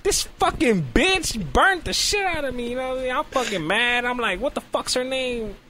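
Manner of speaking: high-pitched comic fury; acted outrage. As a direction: rant high, as if the burning just hit. Retold furious thought at burning.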